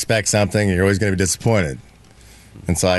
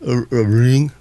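ordinary delivery

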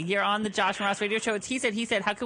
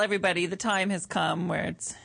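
ordinary talk, nothing marked

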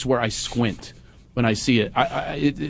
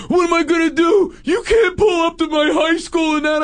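put-on high, loud teen whine; whiny teen son; 4 dB louder than usual. Son dreading school drop-off, high whining shout. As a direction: whine like a mortified teenager.

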